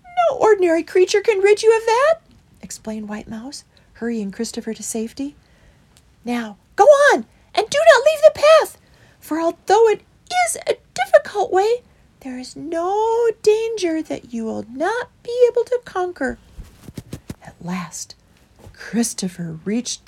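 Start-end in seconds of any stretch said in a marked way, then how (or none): 0.0-2.1 high, loud little mouse voice. A narrator's reading. 6.2-16.4 high, loud, insistent mouse. A narrator's reading.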